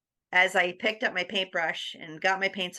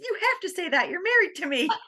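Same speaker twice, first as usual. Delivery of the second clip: high, playful teasing; teasing her husband in a high voice; teasing retort; 12 semitones higher than usual. Retold or imitated. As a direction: tease him, voice pitched up.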